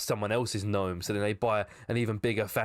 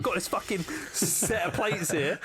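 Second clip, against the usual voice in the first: TV presenter voice; 9 semitones higher than usual. Retold or imitated. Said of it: "Antiques Roadshow and they're like".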